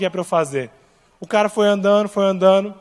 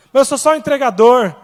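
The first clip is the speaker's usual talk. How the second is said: objecting listener; 4 semitones higher, 7 dB louder than usual. Acted. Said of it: "Deus vai te usar".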